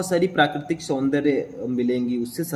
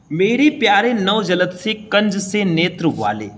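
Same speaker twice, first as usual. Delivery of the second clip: slow, raised, declaiming the verse; poem recitation; 6 semitones higher, 6 dB louder, slower than usual. Shown as a demonstration. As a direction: recite it like a poet on stage. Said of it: "teacher recites the poem line". (धीमे, ऊँचे स्वर में कविता पाठ)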